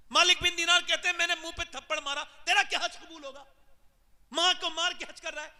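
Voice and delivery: high, loud and furious, preaching; fiery scolding shout. Acted. Preacher voicing Malik bin Dinar scolding the son, high and loud. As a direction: thunder it at the sinner.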